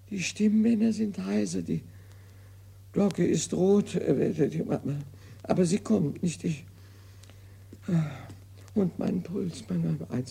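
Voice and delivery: put-on fussy old invalid grumbling over his bills; Molière's imaginary invalid, complaining to himself; hypochondriac Argan. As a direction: play the petty, self-pitying patient. Acts Argan's monologue.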